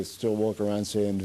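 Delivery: normal talking voice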